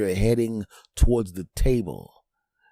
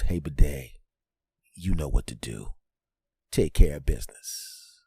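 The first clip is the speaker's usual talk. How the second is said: sinister sink; 8 semitones lower than usual. Acted. Sink ordering the bidet to strike, low mob-boss voice. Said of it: "and the sink goes".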